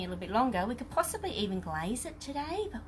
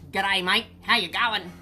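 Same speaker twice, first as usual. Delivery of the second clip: lower, louder mock-Aussie greeting; 4 semitones lower, 6 dB louder than usual. Host shows what people think Aussies say, mock Australian accent. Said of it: "some people say, Aussie go".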